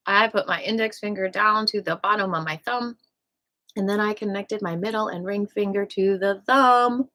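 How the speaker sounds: usual speaking voice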